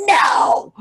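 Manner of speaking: high shrieked No; hammed-up wailing refusal; mock shriek. Refusing her friend Dana's demand with a shrieked hammed-up No. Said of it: "And I'm like".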